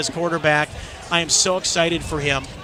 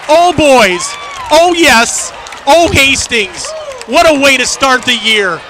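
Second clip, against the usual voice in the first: overjoyed; 9 semitones higher, 11 dB louder, slower than usual. Real, not acted. Elated reaction to the win.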